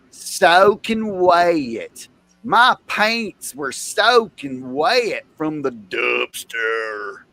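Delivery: very slow, stretched Southern imitation; mock Southern accent. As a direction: drag out the vowels in a hammed-up Southern accent. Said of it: "Why do you talk like that?".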